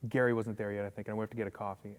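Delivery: ordinary talk, nothing marked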